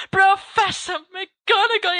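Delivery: high, slow, far-off exclamation of her name; a dashing overblown greeting; bright theatrical hail; exclaiming. A narrator's reading. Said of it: "he exclaims".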